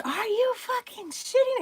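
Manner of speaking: high, slow disbelieving scream; mock scream. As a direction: shriek it at the shooting star in disbelief. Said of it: "And I scream it".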